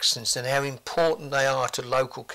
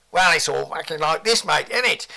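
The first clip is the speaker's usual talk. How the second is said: louder, higher send-up of London speech; mock London accent; 4 semitones higher, 6 dB louder than usual. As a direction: do a scornful caricature of a London accent. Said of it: "that bloody awful London accent".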